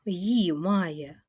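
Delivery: ordinary talk, nothing marked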